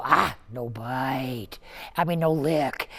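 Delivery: slow, low, calm "Ah, no bite"; slow calm correction. Shown as a demonstration. Trainer showing how to calmly tell a licking dog no. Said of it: "you slow, you go".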